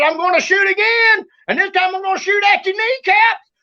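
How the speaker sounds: high-pitched warning yell; retold shouted threat. Boy threatening the creature with his gun, retold high yell.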